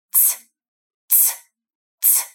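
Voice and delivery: voiceless cymbal hiss "Ts"; ts sound. Shown as a demonstration. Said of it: "tenta imitar comigo o som que só o prato de uma bateria faz".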